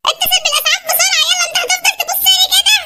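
high, frantic half-crying shouts; a mother screaming for an ambulance, near tears; panicked mother. Acted. Mother blaming Hamokasha for daughter's faint, high frantic wails.

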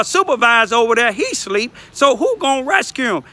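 normal talking voice

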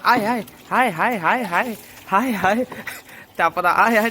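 theatrical high wailing hai hai. Acted. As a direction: overdo the fussing like an aunty. Fussing over dog's bath.

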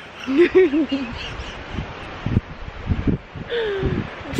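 long, high giggling fit; helpless giggle. Real, not acted. Host cracking up at her own muddled facts, helpless giggle.

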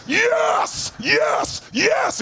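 fired-up yes. Retold or imitated. Fire-walker making a strong move, high triumphant shouting. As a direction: commit with total conviction.

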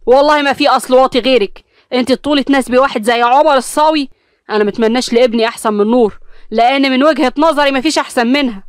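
loud heated retort; husband snapping back in anger; angry retort. Acted. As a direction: hit back hard at her insult. Husband shouts back in quarrel.

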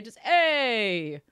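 crappy Italian plumber voice sliding into New York; loud, hammed-up pizzeria guy hollering; Mario. Streamer shows off a bad impression, crappy mock Mario. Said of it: "really crappy, like, Mario impersonation".

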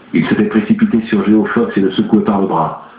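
ordinary delivery

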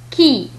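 "كي" with a plain k sound. Shown as a demonstration. Teacher modelling French Q for learners, k in "كي". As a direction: say "كي" like Arabic kaf plus i. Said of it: "وهو ينطق مثل الكاف".

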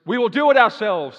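loud, high proud boast; voice of human pride. Retold or imitated. Preacher voicing human pride as a loud boast. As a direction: boom it out as arrogant humanity. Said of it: "says human pride and human power".

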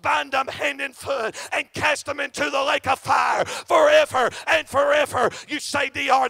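loud, driving revival preaching; shouting gospel preacher; fiery preaching. Acted. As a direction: preach it hard at the crowd. Preaching at full force.

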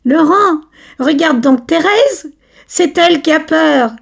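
high, loud jeering call; mocking shout. Camille calls out mockingly to Laurent about Thérèse. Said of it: "Cria Camille".

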